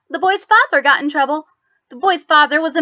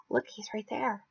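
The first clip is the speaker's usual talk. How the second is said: hushed fear; 2 semitones lower, 17 dB quieter than usual. Acted. Frightened hushed remark.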